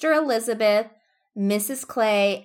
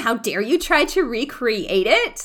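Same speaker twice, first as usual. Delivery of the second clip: raised, loud, affronted rant; hammed-up indignation; mock fan indignation; 3 semitones higher, 5 dB louder than usual. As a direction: play the outraged superfan.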